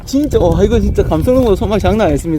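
훨씬 크게 들뜬 외침; shout, thrilled by the pull; excited. Real, not acted. Fighting a strong fish. (흥분해서 크게 외침)

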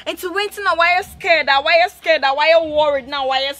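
loud, exasperated demand for answers; scolding shout. Acted. Sister loudly demanding why her sibling is scared and worried. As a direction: bark at her to explain herself.

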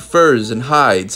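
ordinary delivery, nothing marked

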